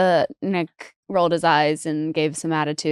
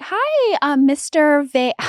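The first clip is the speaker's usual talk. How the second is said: much higher, slowed sing-song doorstep greeting; mock the saleswoman's sweet hello; chirpy salesgirl; 7 semitones higher, much slower than usual. Saleswoman at the door greeting the wife, high mock sing-song.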